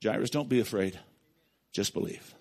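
calm him down, low and soft; gentle Jesus. Retold or imitated. Jesus reassuring the grieving father, low and tender. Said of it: "Look what Jesus said".

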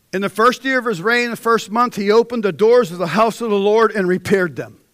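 loud, high, ringing sermon delivery; full-force preaching. Acted. Preacher reading Hezekiah's story to congregation, preaching loud. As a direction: preach it to the back row.

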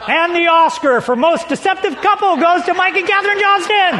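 playful Oscar presenter; mock awards announcer. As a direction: announce the winner like a gala host.

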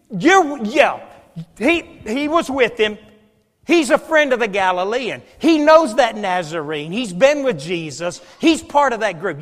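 loud, jabbing accusation; the servant girl pressing Peter, loud and insistent. Acted. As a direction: point the finger and won't let it drop. Girl insists a third time.

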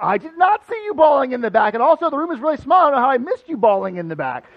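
high, loud disbelieving retort; mock-indignant disbelief; incredulous protest. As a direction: protest that you'd have noticed. Arguing disbelief with Terrell.

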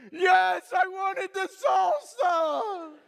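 loud, very high drawn-out wail; mock tearful wail. Son caught red-handed, lip quivering, hammed-up wail. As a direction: imitate a child's bawling confession. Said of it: "the lip starts to quiver".

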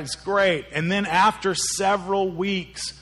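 ordinary talk, nothing marked

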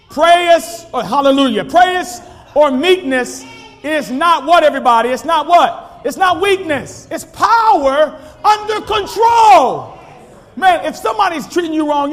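high, loud pulpit shout; shouting preacher, pressing hard; preaching at full force. Acted. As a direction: call the church out with fire.

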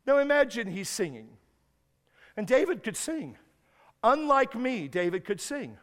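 ordinary talk

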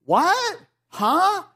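high, loud puzzled yelp; sudden bewildered double take; confused outsider blurting out; baffled what. Retold or imitated. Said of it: "someone goes".

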